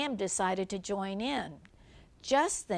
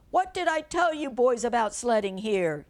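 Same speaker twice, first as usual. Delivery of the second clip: loud scolding shout, a touch higher; angry shout; 4 semitones higher, 7 dB louder than usual. Acted. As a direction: yell at the boys from the doorway. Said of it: "he shouted from his door".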